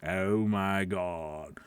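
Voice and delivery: low, soft, slow exasperated groan; mock groan. Imagined viewer groaning at the host, low and slow. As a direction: sigh it out like a fed-up viewer. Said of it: "people are probably like".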